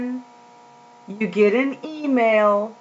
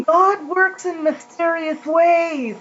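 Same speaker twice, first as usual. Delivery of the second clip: normal talking voice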